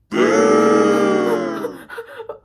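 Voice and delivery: long, loud, high panicked gasp; a drawn-out shrieking gasp of panic. Retold or imitated. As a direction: gasp as if the worst is happening. Full panic on the ride.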